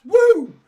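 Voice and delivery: high, loud "woo"; whooped cheer. Retold or imitated. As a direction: let out a piercing whoop. Mock cheer of a pro-choice heckler.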